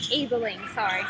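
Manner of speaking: "Ebeling" said carefully in three syllables; corrected name. Real, not acted. Apologising to a player, "Ebeling" in three syllables. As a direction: correct yourself softly, giving "Ebeling" its middle syllable. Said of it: "I think I pronounce it terribly".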